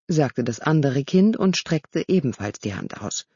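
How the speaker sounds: plain reading voice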